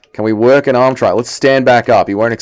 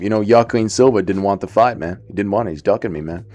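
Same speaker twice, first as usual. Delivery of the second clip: lightly imitated regular American accent, lower and quieter; low-key American guy impersonation; Sean Brady; 4 semitones lower, 6 dB quieter than usual. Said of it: "That's just a regular American accent".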